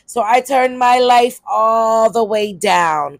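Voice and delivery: high, loud, slow punchline shout; comic shout. Real, not acted. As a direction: belt it out like a comedy punchline. Comic outburst about changing life.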